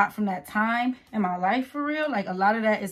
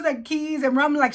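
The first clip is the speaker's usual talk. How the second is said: ordinary talk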